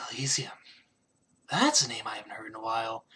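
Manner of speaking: put-on low whisper from a startled drunk; drunken awe. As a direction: let it out softly, eyes widening at the name. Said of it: "even in his drunken stupor".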